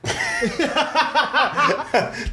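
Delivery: shrieking high-pitched laugh; real high cackle. Real, not acted. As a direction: burst out laughing. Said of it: "I could just imagine that".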